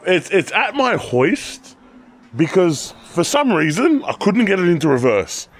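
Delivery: ordinary delivery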